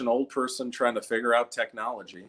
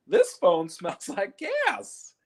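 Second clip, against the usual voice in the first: put-on high, slow puzzled old man; hammed-up high old man voice; mock old man; 11 semitones higher, much slower than usual. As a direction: wonder aloud like a confused grandpa. Old man talking into nozzle.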